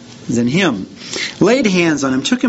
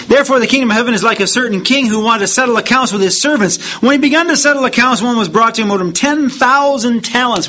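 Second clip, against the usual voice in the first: raised, fast scripture reading; 8 semitones higher, 3 dB louder, faster than usual. Acted. Preacher reading the parable to the congregation, raised and fast. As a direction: read the parable out with rising fervour.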